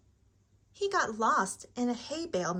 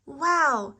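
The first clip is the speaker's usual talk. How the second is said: loud, higher-pitched wow; amazed exclamation; 4 semitones higher, 7 dB louder than usual. A narrator's reading. On the runaway pumpkin ride, amazed exclamation.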